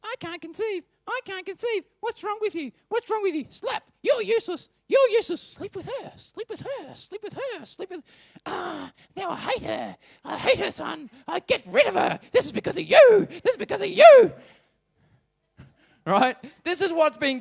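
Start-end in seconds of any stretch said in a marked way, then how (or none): 0.0-5.4 high, frantic, escalating into a scream; shrill frantic wife. Acted. Preacher acts out Sarah screaming at Abraham. 5.4-8.0 high and much softer, a pleading hiss; hushed urging. Acted. Sarah urges Abraham, dropping to a hush. 8.5-14.3 high, frantic, escalating into a scream; shrill frantic wife. Acted. Preacher acts out Sarah screaming at Abraham.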